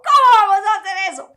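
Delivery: frantic woman's high protest; panicked protest. Retold or imitated. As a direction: cry out in alarmed disbelief. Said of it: "La señora yo creo que lloraba".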